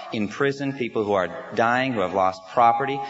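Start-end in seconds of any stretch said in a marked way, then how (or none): none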